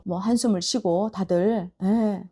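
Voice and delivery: ordinary talk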